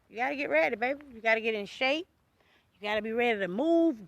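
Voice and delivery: usual speaking voice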